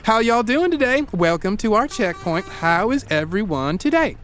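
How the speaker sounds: high, cheery Southern drawl of a greeter; sunny southern drawl. Retold or imitated. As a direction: greet them with bright Southern hospitality. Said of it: "you say in a sunny drawl".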